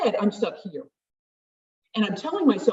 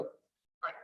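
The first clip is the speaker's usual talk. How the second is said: quick self-instruction; 3 semitones lower, 17 dB quieter than usual. Shown as a demonstration. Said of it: "You can go".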